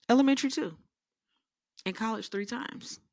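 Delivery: high, loud triumphant boast; shouting the win at the kids; excited brag. Acted. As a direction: crow it out loud. Boasting to kids, pitch jumps.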